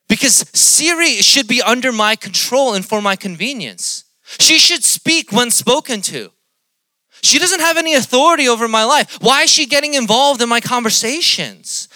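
loud, high-pitched mock outrage; hammed-up complaint at full volume; mock indignation. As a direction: rant as if personally affronted. Mock outrage at Siri speaking up.

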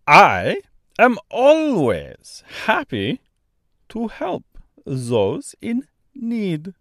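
very slow, high Austrian-French bird; Austrian-French accent. A narrator's reading. Large bird insisting on helping, slow Austrian-French accent. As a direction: proclaim it slowly in a mock Austrian-French accent. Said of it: "in a strangely thick, almost Austrian accent".

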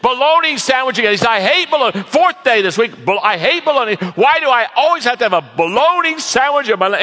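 high, loud whiny complaint; grumpy worker. Retold or imitated. Construction worker griping about his lunch, high and loud. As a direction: whine it like a fed-up laborer.

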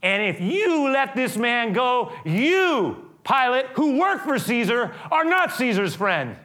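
high, emphatic crowd accusation; accusing threat. Retold or imitated. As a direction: act out a mob challenging an official. Crowd threatens Pilate about Caesar.

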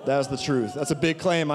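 normal talking voice

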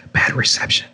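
soft unvoiced aside; whisper. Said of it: "I'm gonna whisper in this mic".

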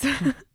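quick embarrassed laugh; little laugh at herself; short giggle. Real, not acted. Laughs at own yawn.

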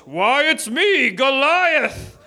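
slow, drawn-out giant voice; theatrical giant making his entrance; looming giant villain. A narrator's reading. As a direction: announce yourself grandly, stretching the words. Giant reveals himself in same voice.

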